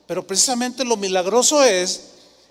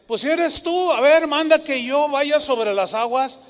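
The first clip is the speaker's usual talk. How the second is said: voicing Peter; 7 semitones higher, 4 dB louder than usual.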